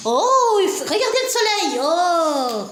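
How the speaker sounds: ordinary talk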